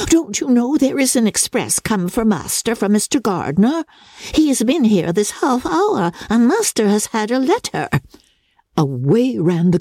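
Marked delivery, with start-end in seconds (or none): 0.1-7.9 astonished housekeeper, pitched up; amazed servant breaking the news, higher voice. A narrator's reading. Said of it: "cried Missus Hill in great astonishment".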